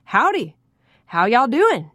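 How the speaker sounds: booming Texas politician greeting; loud Southern drawl. Acted. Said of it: "bellowed in a loud Southern drawl".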